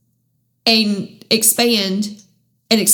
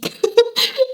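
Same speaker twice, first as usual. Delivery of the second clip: tearful laugh; 14 semitones higher than usual. Real, not acted. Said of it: "I apologize for crying".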